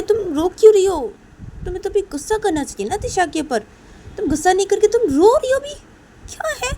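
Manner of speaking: high, exasperated character voice; acted reproach, climbing high and insistent; scolding story character. Voices Tisha scolding crying girl.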